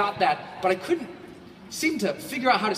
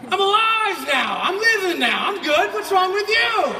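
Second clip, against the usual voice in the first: high, loud and slow, shouting his happiness; joyful shouting back; 12 semitones higher, 7 dB louder than usual. Retold or imitated. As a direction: yell it back at them, overjoyed. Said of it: "And you're like".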